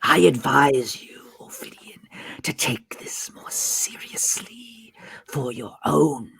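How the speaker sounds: slow, menacing whispered snarl; threatening snake villain, soft and very slow; snarling serpent. Acted. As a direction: hiss the warning in a drawn-out whisper. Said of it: "a snarl in his voice".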